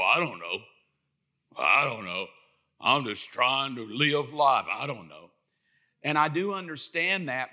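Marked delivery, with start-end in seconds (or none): 0.0-5.3 mock clueless shrug. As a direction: lower your voice and shrug it off helplessly. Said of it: "Because we just go".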